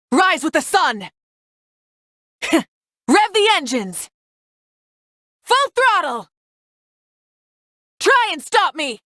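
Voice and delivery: high-pitched, falling combat shouts; battle cry. Acted. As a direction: call out each attack like a battle cry.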